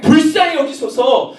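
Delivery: high, insistent plea; crying out. Acted. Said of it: "소리 질렀다니까요".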